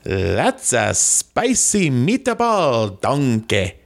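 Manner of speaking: slow, muddled Scottish-Italian accent; attempted Scottish accent. Shown as a demonstration. Guest obeys host's meatball request, slow botched Scottish accent. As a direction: draw it out in hammed-up bad Scottish. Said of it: "Try your Scottish accent".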